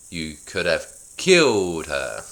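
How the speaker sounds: milk it like a tragedy; overly dramatic. Acted. [name] repeats her accusation to Julian slowly and dramatically. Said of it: "could you be more dramatic?".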